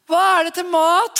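put-on high kid voice asking what's for dinner; child calling back from upstairs. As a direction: play the kid shouting back. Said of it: "hører jeg tilbake".

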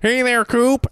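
put-on very high, loud old-man shout; Gordon Cole impression. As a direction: holler a greeting like a half-deaf boss. Cohost joins Twin Peaks impression.

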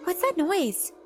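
high-pitched, frightened question; scared voice. A narrator's reading. Charlotte hears spooky noises, scared. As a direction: voice jumps up in alarm. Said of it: "in a scared voice".

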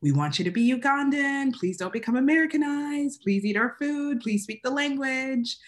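mock the parents begging her to stay Ugandan; imitating parents. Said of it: "My parents are like".

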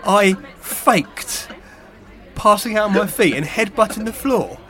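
hammed-up indignant outrage; shaking with anger. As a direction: play it as mock offended fury. Mock outrage at accusation.

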